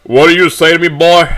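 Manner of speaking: loud, high, threatening challenge; angered father. A narrator's reading. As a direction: challenge the son's back talk sharply. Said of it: "cruel tone fades into anger".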